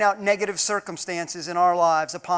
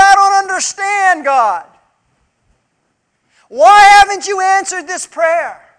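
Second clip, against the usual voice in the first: high, loud, pleading cry to God; anguished crying out; 9 semitones higher, 9 dB louder than usual. Retold or imitated. Believer crying out to God in anguish. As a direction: wail it up high like a desperate prayer. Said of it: "crying out".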